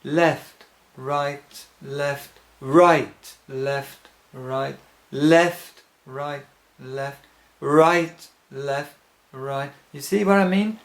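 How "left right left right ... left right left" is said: left, right, left with a louder hit on each first beat; counting three beats. Shown as a demonstration. Teacher counts the steps aloud, stressing each bar's first beat. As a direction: count the steps, punching every third one. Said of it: "set it to three beats to the bar".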